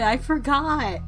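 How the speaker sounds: ordinary talk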